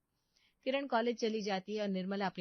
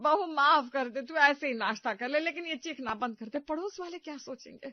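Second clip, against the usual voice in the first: loud, high flustered begging; pleading loudly; 6 semitones higher, 8 dB louder than usual. Acted. Mother-in-law begs wailing daughter-in-law to stop, loud pleading. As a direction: plead over her screaming.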